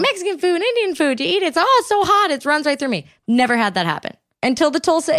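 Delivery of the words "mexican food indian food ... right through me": high whiny mock voice. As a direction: mimic them high and whiny. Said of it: "some people are like".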